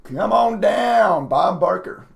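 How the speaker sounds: usual speaking voice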